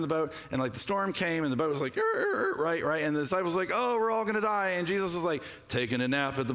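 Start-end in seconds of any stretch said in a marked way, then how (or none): 2.0-2.6 high creaking boat noise; quick high-pitched "er, er, er" sound effect; imitated boat noise. 3.7-4.7 higher-pitched mock-panicked disciples; playful imitation of panic. Disciples panicking in retold storm.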